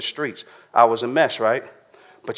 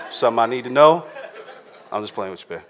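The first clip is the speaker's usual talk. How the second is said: teasing laugh; 2 semitones lower, faster than usual. Real, not acted. Teasing wife in the audience.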